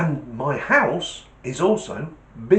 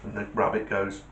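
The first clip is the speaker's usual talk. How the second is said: usual speaking voice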